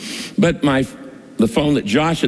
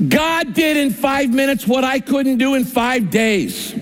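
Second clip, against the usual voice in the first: high, slow, laughing wonder; marvelling; 8 semitones higher than usual. Real, not acted. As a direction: savour each word in amazement. Marvelling at answer.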